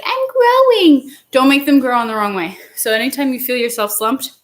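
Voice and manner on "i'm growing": high, loud, slow mock cry from the muscles; voicing the muscles. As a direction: give the muscles a silly proud little voice. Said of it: "They're like".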